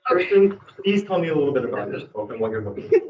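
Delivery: host with a put-on Russian accent; game-show host played as a Russian. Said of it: "And we'll have Russian accent".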